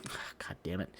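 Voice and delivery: breathy, whispered letdown; disappointed groan. Retold or imitated. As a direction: sigh it out under your breath. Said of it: "I'm like".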